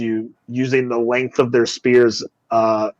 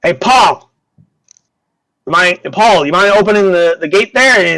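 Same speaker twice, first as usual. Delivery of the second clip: loud, higher hail; calling out; 6 semitones higher, 5 dB louder than usual. Acted. Guard asks Paul to open the gate, calling out. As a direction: call over to a workmate.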